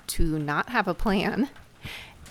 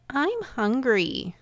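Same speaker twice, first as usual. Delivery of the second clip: higher, slow, drawn-out mock whimper; 7 semitones higher, 4 dB louder, slower than usual. Imagined hungry family member interrupting a parent's rest, whimpering. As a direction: put on a hungry whimper, dragging the words. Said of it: "you hear a whimpering voice say".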